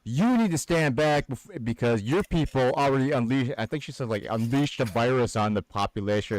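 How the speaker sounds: imitating a bossy stranger; mock scolding. Lady ordering teller to step back, mock lecturing. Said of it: "And she's like".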